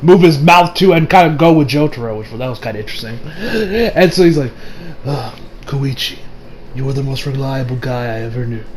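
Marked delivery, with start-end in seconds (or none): 5.0-8.6 imitated quiet sincerity; subdued praise. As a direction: say it low and softly to a friend. Acting hero praising fallen friend.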